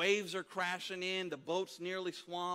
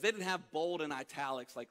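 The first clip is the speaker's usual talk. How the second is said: usual speaking voice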